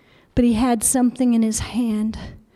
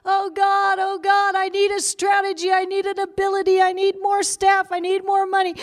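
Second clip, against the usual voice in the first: pitched-up mock pleading to God; mock frantic prayer; 6 semitones higher than usual. Preacher mocking a frantic prayer, pleading higher. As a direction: parody the needy, frantic prayer. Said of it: "not saying".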